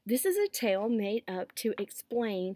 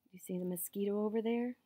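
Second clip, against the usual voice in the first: usual speaking voice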